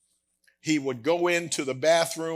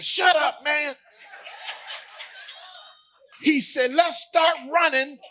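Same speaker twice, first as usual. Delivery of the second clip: big brother yelling orders; brother shouting; 11 semitones higher than usual. Retold or imitated. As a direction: snap him out of it and get him moving. Brother yells at crying boy.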